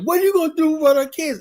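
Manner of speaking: high, demanding parent's voice; mock outraged mother. Parent demanding answers at a school board meeting, high and insistent.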